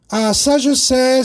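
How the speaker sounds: high, loud, slow triumphant cry; eager exclaim. Acted. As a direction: burst out with the answer. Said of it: "s'exclame Anatole". (s'exclamer, plus aigu et plus fort)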